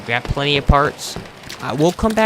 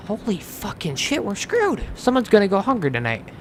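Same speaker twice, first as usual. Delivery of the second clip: frustrated outburst; 8 semitones higher, 4 dB quieter than usual. Real, not acted. Realizes not enough water.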